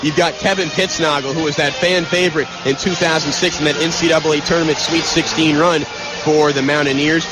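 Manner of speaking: ordinary talk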